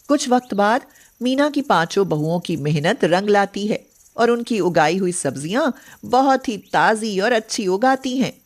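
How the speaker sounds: ordinary delivery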